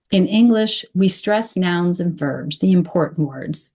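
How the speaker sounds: ordinary talk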